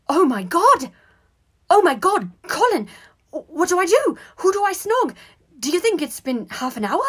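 high, loud panicky squeaks; frantic alarm. A narrator's reading. Boy suddenly asking his brother what to do, panicking. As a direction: blurt the questions in rising panic. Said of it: "with seriousness".

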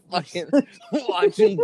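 high, cracked-up words breaking into laughter; laughing while talking. Real, not acted. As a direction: struggle to get it out through laughter. Cracking up telling crude anecdote.

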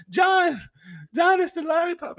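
high, loud squealed announcement; mock hysterical squeal to a friend; helpless laughing, reenacted. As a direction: call out as if dying of laughter. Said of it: "I was laughing really bad".

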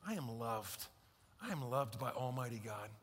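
low, soft and reflective; hushed, reverent repetition; quiet affirmation. Shown as a demonstration. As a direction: say it softly to yourself.

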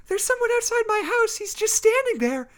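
put-on very high, small frightened girl voice; a scared little voice pleading. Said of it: "sounding frightened".

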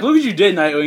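high, loud retold accusation; mock angry blaming. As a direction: scold as the exasperated character. Retold character blaming another.

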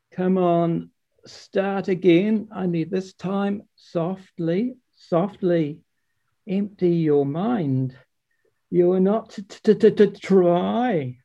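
Father Frost, stammering. Acted. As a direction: coax gently, stumbling on the t's. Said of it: "said Father Frost".

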